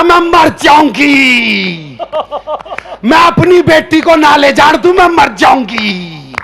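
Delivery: slow, drawn-out hammed-up lament; mock wailing. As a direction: wail it like a dramatic aunt. Aunt clinging, begging at farewell.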